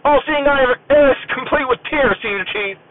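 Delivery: high, stiff and deadpan; straight-faced military reply. Retold or imitated. Sailor explaining his dog tag to the senior chief, deadpan and formal. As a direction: recite it like a recruit at rigid attention. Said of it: "straight face".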